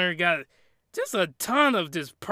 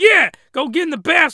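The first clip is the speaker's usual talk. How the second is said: loud, higher, keen urging; playful, pushy cheer; eager egging-on; 8 semitones higher, 9 dB louder than usual. Acted. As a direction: egg him on loudly. Cheering on the character.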